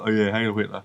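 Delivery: low, soft, offhand mock reply; imitated British driver. British driver answering the Nazi guard, low offhand salute. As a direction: play along half-heartedly, low and quiet. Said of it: "the British guy that you always go".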